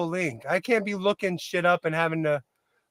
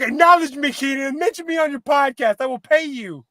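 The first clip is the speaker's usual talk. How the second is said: mock Mecca demanding; 5 semitones higher than usual. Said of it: "Mecca's like".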